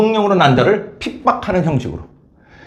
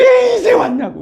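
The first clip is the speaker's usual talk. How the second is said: tearful father's welcome. Retold or imitated. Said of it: "눈물을 흘리면서".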